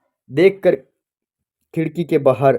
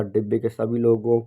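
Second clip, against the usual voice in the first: usual speaking voice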